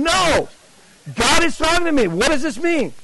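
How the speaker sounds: high, impassioned outburst; agitated insistence. Retold or imitated. Seeker corrects the evangelist in the alley, agitated insistence. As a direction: push each phrase high, desperate for the meaning. Insisting God is talking to him.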